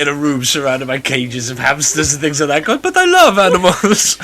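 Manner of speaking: mock Italian accent for the animal lover. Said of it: "Still with an Italian accent".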